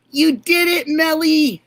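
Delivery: high, loud burst of joy; thrilled shout of congratulations; excited cheer. Real, not acted. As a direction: celebrate her like she just won. Cheering viewer who bought membership.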